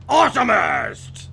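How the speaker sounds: loud booming slow announcer shout; monster truck ad. Acted. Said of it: "I can't stop saying it like that".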